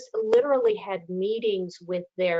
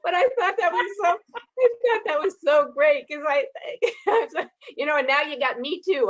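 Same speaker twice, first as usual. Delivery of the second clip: high, quick, through real laughter; laughing while talking; 8 semitones higher than usual. Real, not acted. Retelling a colleague's joke to the panel, laughing. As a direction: talk through a fit of laughter.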